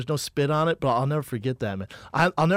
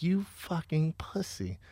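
slow, hushed mock disgust; retold contempt; 8 dB quieter, slower than usual. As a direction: mutter it slowly in scorn, as if recalled. Recalls silently judging his friend.

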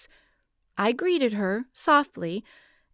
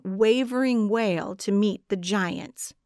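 usual speaking voice

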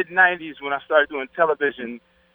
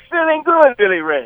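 Eddie Murphy impression; 11 semitones higher than usual. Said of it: "as, uh, Eddie Murphy said in Trading Places".

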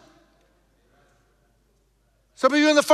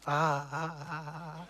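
stammering dealer; 8 semitones lower, 15 dB quieter, much slower than usual. Retold or imitated. Dealers stammer when questioned.

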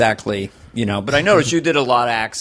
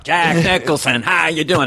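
lightly voiced celebrity impression, pitched up; Jack Nicholson; 5 semitones higher than usual. Comic mimicking Robin's rough impressions, Jack Nicholson voice. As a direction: toss off a rough impression, higher than usual. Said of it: "It never stopped Robin".

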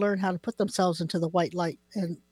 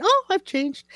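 high, bright "oh" of discovery; sudden realization; 12 semitones higher, 5 dB louder, slower than usual. Retold or imitated. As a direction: act out waking up changed. Mock sudden realization quote.

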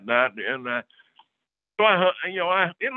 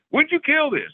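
higher, louder, urgent question; eager surprise; 11 semitones higher, 4 dB louder than usual. Retold or imitated. Hunter quizzing the retired scientist about the buck, eager and higher. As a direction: press him for the date, amazed.

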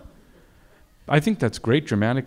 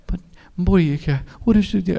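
higher, louder puzzled mutter to himself; a baffled aside, pitched up; confused director; 8 semitones higher, 3 dB louder than usual. Retold or imitated. Director watching a Polish take, higher baffled aside. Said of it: "and I".

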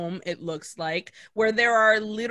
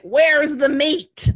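put-on slow, louder demanding whine like the old lady in the ad; Wendy's old lady; 4 semitones higher, 8 dB louder, slower than usual. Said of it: "Like the old lady from the Wendy's commercial".

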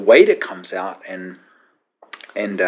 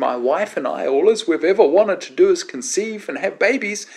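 high, strained pleading, climbing higher; hammed-up distraught request, pitched way up; imitated tearful plea; 12 semitones higher, 4 dB louder than usual. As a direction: beg as if through tears. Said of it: "he burst into tears again and says".

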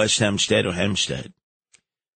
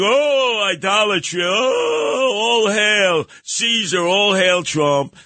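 higher, slow hammed-up worshipful wail; mocking Sid; 8 semitones higher, slower than usual. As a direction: ham up a fawning host's adoration. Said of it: "like Sid this morning".

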